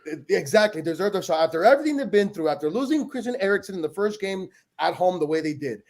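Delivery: normal talking voice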